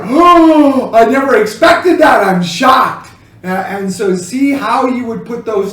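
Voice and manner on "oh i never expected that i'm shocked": high, loud shocked outcry; shocked exclamation. Shown as a demonstration. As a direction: cry out as if caught off guard. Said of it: "Was it more like".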